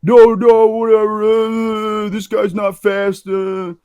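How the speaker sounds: lightly hammed-up drunk shrugging them off; mock dismissive tough guy. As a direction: drunk stranger waving off his wife, mock brush-off. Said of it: "And he's like".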